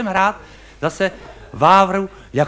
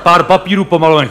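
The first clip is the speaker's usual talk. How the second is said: normal talking voice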